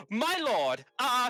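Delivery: gasp, then high, loud alarm; hammed-up horrified outburst after a gasp; shocked wizard. Wizard sees the house burning, shocked. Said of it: "And Esakir's like".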